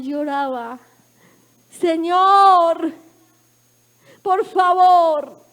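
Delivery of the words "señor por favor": loud, higher, crying plea to God; tearful plea. Retold or imitated. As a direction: wail it out like a grieving sister begging. Sister crying, pleading at bedside.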